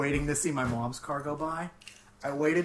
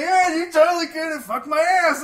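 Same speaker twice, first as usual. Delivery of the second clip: much higher, louder mock agreement; exaggerated high quote; 14 semitones higher, 11 dB louder than usual. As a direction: squeak it out in disbelief.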